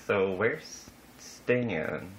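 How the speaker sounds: drawn out slowly, each word stretched; slow, searching where's Stan; slow pointed question. Retold or imitated. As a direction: drag out the question, puzzled and slow. Asking hostess about date.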